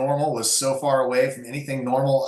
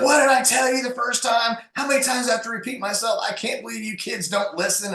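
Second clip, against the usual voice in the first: much higher, exasperated retold snap at his kid; angry outburst; 11 semitones higher than usual. Father retelling how he snapped at his daughter, exasperated and much higher. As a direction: reenact losing your temper over being interrupted again. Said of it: "I just went off the deep end".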